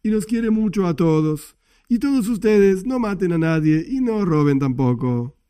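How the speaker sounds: sugary, slow-lilting mock homily; simplistic feel-good rabbi. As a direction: parody a rabbi preaching simple, pretty platitudes. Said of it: "no un estudio ... no es algo así sencillo, y suena lindo".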